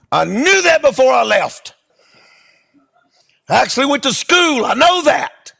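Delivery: indignant preacher answering the leper; indignant retort. Retold or imitated. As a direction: snap back, a bit offended.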